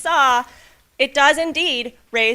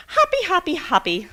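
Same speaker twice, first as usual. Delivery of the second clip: bouncy singsong; 8 semitones higher than usual. Acted. Frog boy hopping happily.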